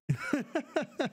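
a short, high burst of laughter; real laugh. Real, not acted. Conceding to Impact, a sudden high laugh.

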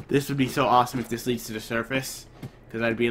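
normal talking voice

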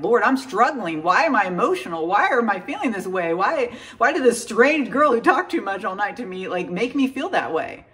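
a hammed-up cry to God, pleading; mock crying out. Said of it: "crying out".

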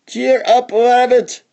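a high, cheery playful call; high mocking cheer; teasing call. A narrator's reading. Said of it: "they'd call out to each other".

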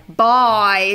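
"bye" with a big, wide Australian vowel. Retold or imitated. Proud mother copying daughter, "bye" with big drawn-out vowel. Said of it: "These big vowel sounds".

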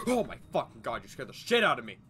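gasping, loud startled shout; startled scream. Real, not acted. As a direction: jump out of your skin and yell. Jump scare from teammate.

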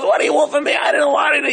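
desperate shout. Retold or imitated. Poor Yid shouting back at the innkeeper, high and desperate. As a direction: cry out in defence.

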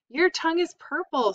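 put-on high, gleeful shriek of a kid; mock scream. A narrator's reading. Fern teasing Avery from the swing, high mock scream. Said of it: "screamed Fern".

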